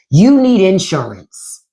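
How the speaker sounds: normal talking voice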